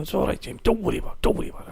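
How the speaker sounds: soft, high, hushed reassurance; retold soothing. Friend telling Jamie not to worry, soft imitated soothing. Voices Chris calming him down.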